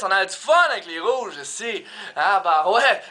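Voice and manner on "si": ordinary delivery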